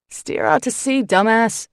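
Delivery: loud barked order; barking. Acted. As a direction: bark the order. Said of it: "he barks".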